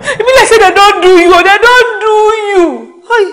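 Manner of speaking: high wailing cry, laughing through tears; crying lament. Acted. Friend lamenting over Jimo, high crying wail. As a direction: wail it out, half laugh half sob.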